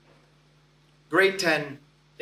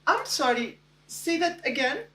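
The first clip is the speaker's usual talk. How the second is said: put-on high, European-accented polite query; 9 semitones higher than usual. Future wife asking to hear the name, European accent. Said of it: "delightful European accent".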